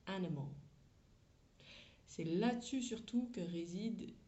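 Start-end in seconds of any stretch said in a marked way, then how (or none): 0.1-0.5 "animal" in English with I and A reduced to schwa; voyelles réduites. Shown as a demonstration. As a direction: say "animal" as AN-uh-muhl, swallowing the weak vowels. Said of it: "C'est des petits E". (« animal » à l'anglaise, I et A réduits en petits e)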